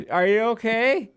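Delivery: high, loud, laughing through the words; laughing sheepishly. Retold or imitated. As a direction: caught in the act, giggling the question out. Caught by the instructor, laughing.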